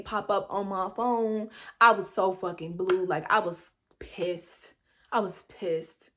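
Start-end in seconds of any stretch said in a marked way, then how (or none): none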